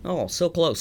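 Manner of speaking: ordinary talk, nothing marked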